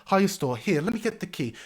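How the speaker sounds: high, loud flustered apologies; high flustered landlady; flustered innkeeper. Acted. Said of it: "I've got myself all in a fluster".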